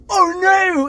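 high, loud comic wail; mock panic. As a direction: yell it like a hapless driver in a chase. [name] panicking during car chase.

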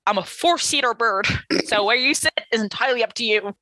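lightly voiced cheery bird; bird character voice. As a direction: chipper giant bird offering seats.

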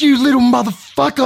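high, loud mock outrage. Man mock-raging at cheeky kid, high and loud. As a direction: playfully blast the cheeky kid. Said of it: "I said".

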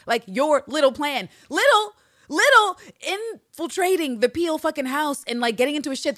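mocking disbelief. Real, not acted. Host mocking Carolyn's little plan, mocking disbelief. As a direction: sneer at the word little.

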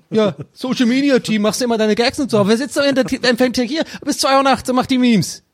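high, sneering put-on voice; mock high-pitched colleague. Mocking the meme team, squeaky sneering.

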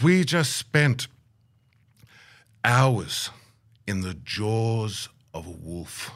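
very slow, long pauses between phrases; imitated elder, ominous and measured; grave dramatic telling. Elder's dramatic account of danger.

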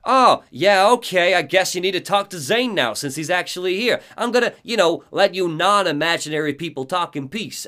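acted huffy concession; Barry. Friend leaving Alan alone with Zane, put-on voice. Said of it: "Barry is like".

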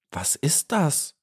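slow, timid little question; anxious. A narrator's reading. Little bee asking the dragonfly, mildly anxious. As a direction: ask it shrinking back. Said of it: "fragte Maya ängstlich".

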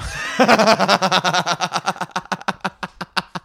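long rattling cackle. Real, not acted. Host jokes about sixty-four bar solos, long cackle. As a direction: burst out laughing and run it down. Laughs at solo joke.

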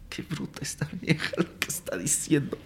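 near-whispered mock amazement; hushed inner grumble; muttered aside. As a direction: whisper it to yourself under your breath. Acting out his private thought.

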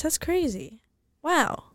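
ordinary delivery

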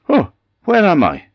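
high, loud, bewildered wife voice; startled wife waking on the ground; sleepy wife waking. A narrator's reading.

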